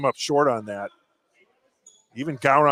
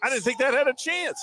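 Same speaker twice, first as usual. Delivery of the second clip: much higher, amazed disbelief; surprised; 10 semitones higher than usual. Real, not acted. Co-host amazed the floater dropped. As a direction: sound stunned it went in.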